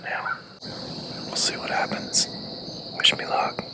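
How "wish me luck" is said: normal talking voice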